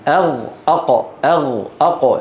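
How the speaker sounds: أغ with gh, then أق with a sharp q; contrasted letters. Shown as a demonstration. Teacher showing students the near articulation points, letters kept apart. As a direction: alternate أغ and أق, each clearly distinct. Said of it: "بين الغين".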